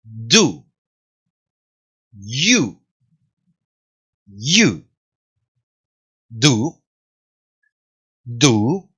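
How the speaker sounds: each word said alone with long pauses. Shown as a demonstration. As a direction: break the question into single slow words. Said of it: "¿Se dan cuenta?".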